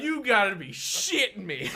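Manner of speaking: loud, laughing "you gotta be kidding"; half-laughing, half-outraged; laughing disbelief. Retold or imitated. Exasperated retold outburst, laughing.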